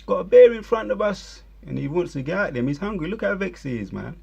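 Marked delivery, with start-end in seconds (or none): 0.0-1.4 high, panicked screaming tourist; mock scared tourist scream. Mocking the tourists screaming at the bear. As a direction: shriek like a terrified tourist at a bear. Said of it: "They're screaming".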